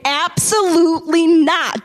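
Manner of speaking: "Absolutely not" stretched out firmly; emphatic refusal. Retold or imitated. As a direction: lean hard and slow on "Absolutely not". Acted firm refusal to devil.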